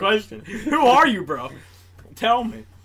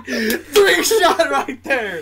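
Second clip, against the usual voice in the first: usual speaking voice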